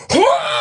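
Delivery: high mock thrill scream; hammed-up joyful scream. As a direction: let out a wild yell of speed. Scream while shovel racing.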